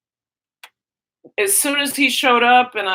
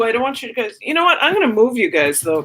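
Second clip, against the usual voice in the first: ordinary talk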